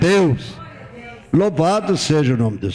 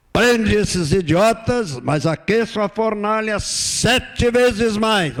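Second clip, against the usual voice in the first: slow, raised royal order; king's command; 7 semitones higher, slower than usual. Retold or imitated. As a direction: bark it like a tyrant king. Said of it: "Quando ele disse".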